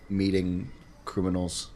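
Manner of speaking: ordinary delivery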